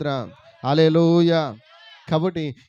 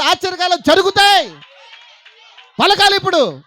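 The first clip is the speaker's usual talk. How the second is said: higher, louder, pounding sermon; fiery preaching; 9 semitones higher, 11 dB louder than usual. Acted. Preacher shouting to the congregation at full force.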